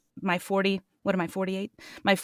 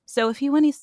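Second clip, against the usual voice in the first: usual speaking voice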